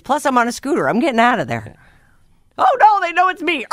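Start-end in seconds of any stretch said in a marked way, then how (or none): 2.6-3.7 high laughing shout; excited shout. Pretend. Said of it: "excited laughing shout".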